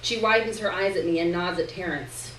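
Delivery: ordinary delivery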